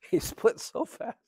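high, laughing through the words. Real, not acted. Telling about the man who fled, laughing. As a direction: chuckle it out, amused at his exit.

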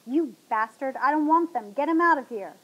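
loud, indignant cursing; angry outburst. A narrator's reading. Trapped woman cursing her captor.

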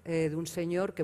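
normal talking voice

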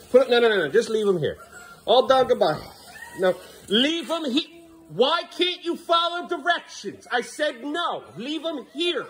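high, exasperated scolding. Real, not acted. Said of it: "I'm sorry I raised my voice".

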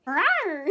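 cute mock monster growl; playful rawr.

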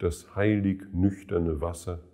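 ordinary talk

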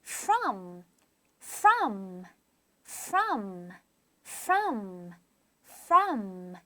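"From" with a clearly sounded f at the start; careful sound drill. Shown as a demonstration. Teacher drills a hard word, "From" said carefully with every sound. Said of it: "사운드를 잘 내줘야 돼요".

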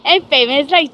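ordinary talk

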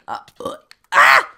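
grunts breaking into a loud cry; startled yelp. Real, not acted. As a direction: build from hesitant noises to a sudden yelp. Startled while descending the mountain.

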